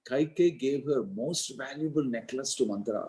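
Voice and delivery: ordinary delivery, nothing marked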